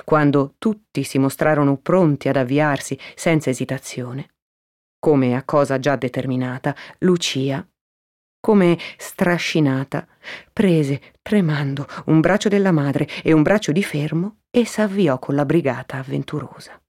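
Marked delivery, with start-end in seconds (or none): none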